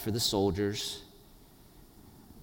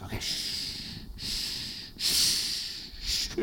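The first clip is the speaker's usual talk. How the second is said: soft, breath-only shushing; acted soothing hush; hushing a baby; 4 semitones lower, 8 dB quieter, much slower than usual. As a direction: shush an infant gently. Hushing a fussy baby at night.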